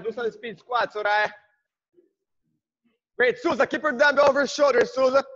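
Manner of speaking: normal talking voice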